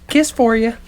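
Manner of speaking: mock mom voice. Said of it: "looks at him and goes".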